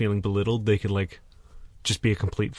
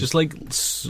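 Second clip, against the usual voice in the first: ordinary delivery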